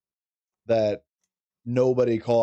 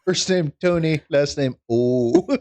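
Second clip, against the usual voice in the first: mock name spelling; 5 semitones higher, 6 dB louder, slower than usual. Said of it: "joke spelling of name".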